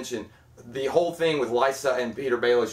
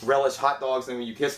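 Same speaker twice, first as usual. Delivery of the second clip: normal talking voice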